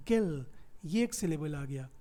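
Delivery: usual speaking voice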